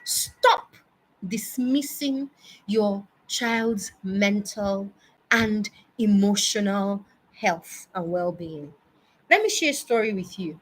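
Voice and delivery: usual speaking voice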